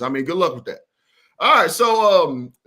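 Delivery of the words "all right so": high, loud reset. Real, not acted. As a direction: grab the floor back. Loud reset before last point.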